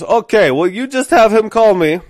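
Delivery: put-on high, loud accented manager reply; Farhad's foreign accent. Manager answering the made-up excuse, foreign accent. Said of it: "And he goes".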